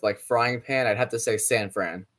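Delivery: usual speaking voice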